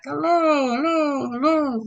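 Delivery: high, hollow repeats, each falling; sing-song echo imitation, overlapping hellos; echo yelling back. Shown as a demonstration. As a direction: imitate an echo bouncing off cave walls. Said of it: "it usually yells back".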